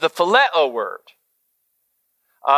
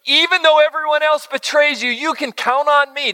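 brash arrogance; 8 semitones higher than usual. Retold or imitated. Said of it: "brashly, arrogantly said".

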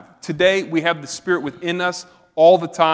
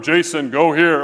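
voice of God; 6 dB louder than usual. Pretend. God calling the preacher from the room's corner, lightly commanding voice. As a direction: call the order from across the room, a hint of divine command. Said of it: "I'd hear a voice out of the corner".

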